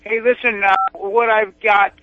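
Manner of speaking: usual speaking voice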